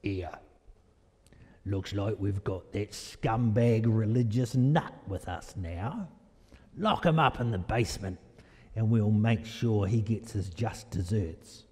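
low, gruff Cockney guard. Acted. Preacher playing the guard locking up the religious nut, gruff Cockney. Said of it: "in my best Cockney gruff voice".

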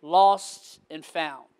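normal talking voice

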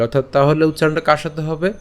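ordinary delivery

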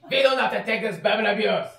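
indignant German shout; loud German accent. Acted. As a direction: protest loudly in a German accent. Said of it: "these fake German accents".

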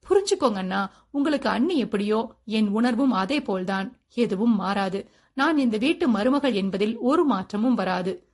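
plain reading voice

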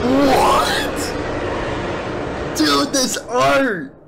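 totally floored, slow exclamations; awestruck cry. Real, not acted. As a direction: stretch each word in high-pitched awe. Floored by the art.

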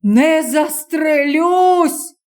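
high, slow, strained cry; hoarse shout. Acted. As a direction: tear it out of a raw throat. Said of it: "хрипко крикнув чоловік".

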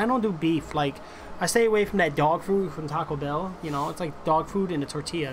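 ordinary delivery

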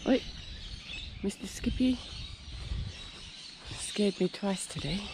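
ordinary talk, nothing marked

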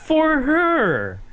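high, loud correction; emphatic exclamation. Real, not acted. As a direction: cry it out high, then let it drop. Exclaims amid laughter, correcting himself.

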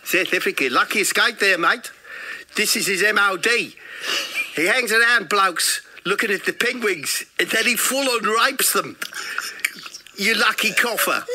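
high, emphatic South African voice; hammed-up South African, punching out each accusation; South African copper. Copper tells tourist about the bloke, South African accent. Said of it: "there's a South African copper there".

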